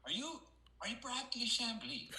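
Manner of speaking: put-on high, lilting Irish accent. Irish golfer on the green asking the stranger's name, in an Irish accent. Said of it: "excuse my Irish accent".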